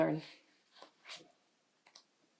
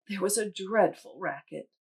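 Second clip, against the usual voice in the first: plain reading voice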